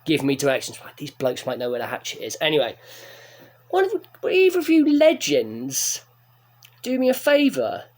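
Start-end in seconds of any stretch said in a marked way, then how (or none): 3.7-7.8 bright polite ask. Retold or imitated. Asking bouncers a favour.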